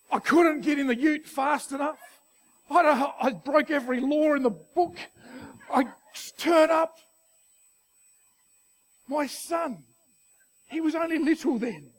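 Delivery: high, loud, urgent storytelling; excited retelling. Real, not acted. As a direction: race through the rescue story. Racing back for son.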